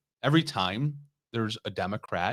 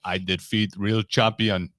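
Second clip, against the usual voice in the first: Drago impression; slower than usual. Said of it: "Rocky Four".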